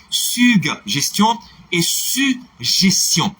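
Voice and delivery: teacher spells out the two sounds slowly; slow pronunciation demo. Shown as a demonstration. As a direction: contrast "suggestion" with g-j and "suggestion" with only j. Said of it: "Autre différence entre suggestion".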